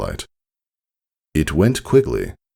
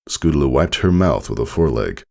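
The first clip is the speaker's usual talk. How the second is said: usual speaking voice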